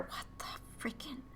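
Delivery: breathless stunned whisper; shocked whisper. Real, not acted. Reader stunned by a vision, whispering in shock. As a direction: whisper it in disbelief.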